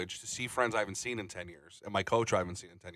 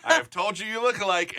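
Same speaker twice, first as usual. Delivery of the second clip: excited; 13 semitones higher, 5 dB louder than usual. Real, not acted. Insists friends look alike.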